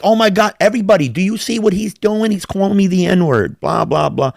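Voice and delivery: very high mock-scandalized voice; exaggerated high-pitched tattling; imitated nerdy outrage. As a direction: mimic a nerd appealing to onlookers. Imitates nerdy guy's appeal to onlookers.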